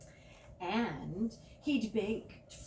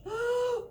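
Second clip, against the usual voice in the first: sharp gasp; 9 dB louder than usual. Acted.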